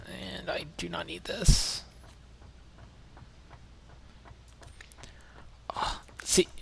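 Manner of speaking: whispered aside; hushed, to yourself. Real, not acted. Aside while discarding item.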